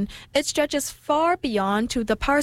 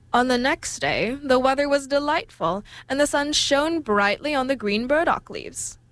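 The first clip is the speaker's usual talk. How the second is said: usual speaking voice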